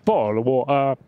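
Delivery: clipped mock British bottle of water; "Bottle of water" with glottal Ts in a British accent. Shown as a demonstration. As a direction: swallow the Ts, British. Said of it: "most British accent".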